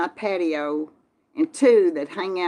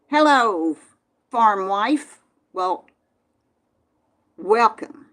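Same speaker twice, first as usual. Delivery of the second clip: bright, louder hello to a viewer; cheery greeting; 2 semitones higher, 5 dB louder, slower than usual. Real, not acted. Greeting a live chat viewer with a cheerful hello. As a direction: call out a happy welcome.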